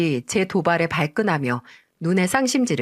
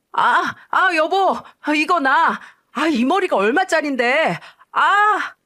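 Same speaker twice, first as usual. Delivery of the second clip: husband's screams; 8 semitones higher, slower than usual. Acted. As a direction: cry out as your hair is yanked. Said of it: "비명을 지릅니다". (아내에게 머리채 잡힌 남편의 높은 비명)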